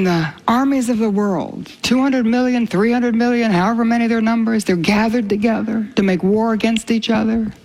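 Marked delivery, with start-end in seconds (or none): none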